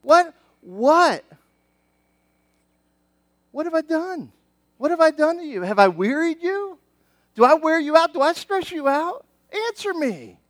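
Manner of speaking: high, pleading disbelief building to a demand; a wounded father asking what he did wrong; God as hurt parent. Acted. God questioning his weary people, high and pleading. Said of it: "He's like".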